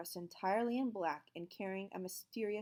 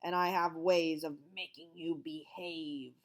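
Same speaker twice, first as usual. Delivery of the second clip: menacing; 4 dB louder, slower than usual. A narrator's reading. Stern teacher threatening the class, slow and menacing. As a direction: savour the threat. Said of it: "smiled menacingly".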